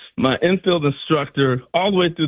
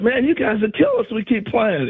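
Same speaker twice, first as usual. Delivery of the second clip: higher, joking ballplayer ribbing; imitating George Brett; 7 semitones higher than usual. Brett teasing the rookie at second base, joking. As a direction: rib the young player like a friendly vet. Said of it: "He's like".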